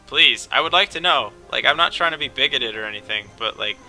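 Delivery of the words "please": usual speaking voice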